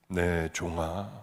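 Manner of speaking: low, slow, solemn divine voice; a calm deep call from above, very slowly; quiet, drawn-out heavenly call; voice of the Lord. Retold or imitated. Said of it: "주님의 음성 같은 거예요".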